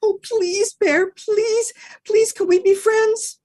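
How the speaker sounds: high, begging dog voice; high puppy pleading; pleading little dog. Acted. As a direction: beg sweetly for friendship. Dog begs bear.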